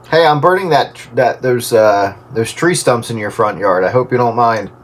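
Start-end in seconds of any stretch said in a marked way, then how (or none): none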